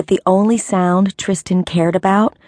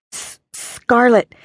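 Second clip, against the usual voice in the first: choked cry; 5 semitones higher than usual. Acted. Choked cry over her body.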